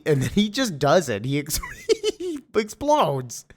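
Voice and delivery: breaking up with laughter; laughing. Real, not acted. Narrator amused by villain blowing himself up, laughing. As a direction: laugh through the words, pitch climbing.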